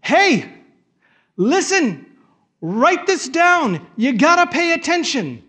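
Paul shouting for attention; urgent shout. Retold or imitated. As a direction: grab them by the collar, write this down. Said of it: "He's like".

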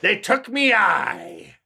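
gruff pirate growl, slow. Retold or imitated. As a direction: talk like an old sea pirate. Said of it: "He's got a big pirate voice".

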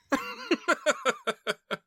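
high, breaking chuckle; laughing. Real, not acted. Co-host amused by the retold sneeze clip, high laughter. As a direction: chuckle helplessly at your own bit. Amused by his own impression.

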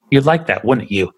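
ordinary talk

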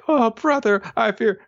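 high, quavering mock lament; melodramatic. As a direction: overplay the sister's despair. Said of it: "Kiku tries to get all melodramatic".

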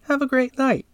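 sing-song chat agent farewell; cheery sign-off. Acted.

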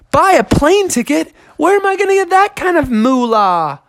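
high, incredulous bear; aghast bear. Acted. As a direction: squeak at the price.

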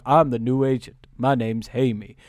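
low-pitched imitation of a robot agent; lightly voiced deep-toned newcomer; Patrick Warburton. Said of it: "and he's like".

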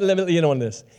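ordinary talk, nothing marked

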